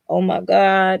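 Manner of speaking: ordinary talk, nothing marked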